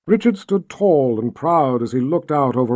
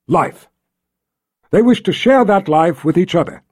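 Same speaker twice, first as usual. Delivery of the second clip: higher, louder, measured oratory; ceremonial wedding address; 5 semitones higher, 5 dB louder than usual. A narrator's reading. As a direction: address a silent crowd with solemn pride. Richard officiates before the crowd.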